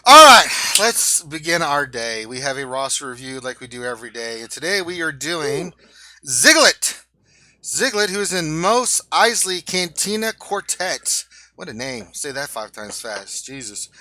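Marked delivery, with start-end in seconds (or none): 0.1-2.3 loud, upbeat show host; loud host opener. Acted. Show host opening segment. 6.3-11.3 loud, higher announcement; announcer voice. Acted. Announcing the featured character.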